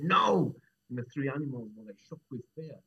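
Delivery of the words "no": sudden loud, high cry of alarm; full-voiced commanding outburst; urgent shout. A narrator's reading. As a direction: shout to stop someone leaping. Old man stops rabbit leaping into fire.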